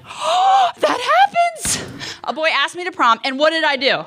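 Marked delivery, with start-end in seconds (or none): 0.0-1.8 gasp, then very high squeak; shocked gasp. Retold or imitated. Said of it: "I was like".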